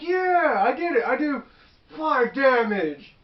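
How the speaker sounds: high, loud cheer slowing on the last words; mock triumph. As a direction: imitate a player crowing over a hit. Retold player celebrating a hit.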